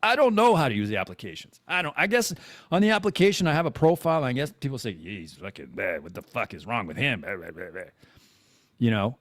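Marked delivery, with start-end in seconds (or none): 4.9-7.8 low, soft grumbling voice; dismissive mock chatter; mocking imitation. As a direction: mimic people griping under their breath. Voices what people say about him.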